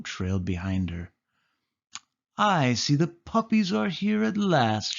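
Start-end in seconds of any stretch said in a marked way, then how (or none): 2.4-5.0 a hint of a haughty villainess; Cruella voice. A narrator's reading. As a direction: purr it like a grand, greedy villain. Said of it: "she said".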